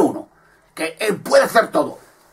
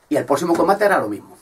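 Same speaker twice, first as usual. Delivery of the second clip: ordinary delivery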